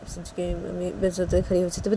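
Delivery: usual speaking voice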